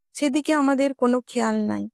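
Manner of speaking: plain reading voice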